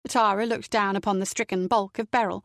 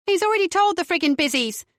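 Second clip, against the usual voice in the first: snapped; 9 semitones higher than usual. A narrator's reading. Mother snapping at the detective questioning her son. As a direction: bite her head off.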